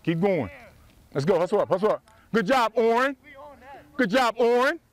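ordinary delivery

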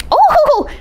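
high, loud delighted oh. Acted. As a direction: squeal with delight. Horse delighted by secret.

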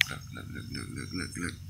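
hushed whisper, barely voiced. Real, not acted. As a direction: whisper so you don't scare it off. Quietly pointing out the grasshopper.

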